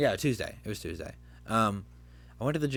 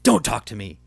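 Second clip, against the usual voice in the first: loud, angry retold snap; fed-up outburst; 12 dB louder than usual. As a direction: act out a manager snapping, suddenly much louder. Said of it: "just fed up with me".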